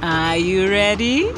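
ordinary delivery, nothing marked

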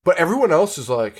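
ordinary talk, nothing marked

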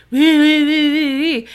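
high mocking whine; whiny squeal. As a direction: whine like a sulky child. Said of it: "And she's like".

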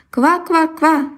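high, slow croaking laugh; frog laughing croak. Acted. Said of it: "засмеялась лягушка".

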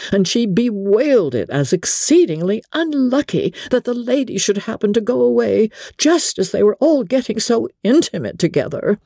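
ordinary delivery, nothing marked

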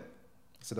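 ordinary delivery

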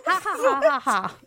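imitated childish cackle, starting high; mock giggly "ha ha ha" like a kid; kiddie cackle. Intern after introducing herself, imitated kiddie cackle. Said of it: "cackles with a kiddie laugh".

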